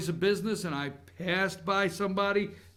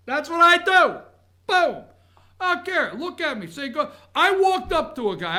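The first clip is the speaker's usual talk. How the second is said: much higher and louder, riled up; heated outburst; 9 semitones higher, 8 dB louder than usual. Real, not acted. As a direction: let it burst out, worked up and loud. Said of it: "worked up about being ignored".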